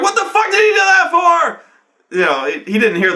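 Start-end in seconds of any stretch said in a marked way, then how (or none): 0.0-1.6 high, loud outraged sergeant with a laugh; laughing mock yell. Police sergeant on the phone hearing the gunshot, loud shout.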